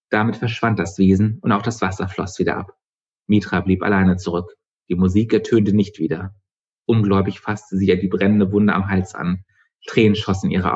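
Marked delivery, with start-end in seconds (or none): none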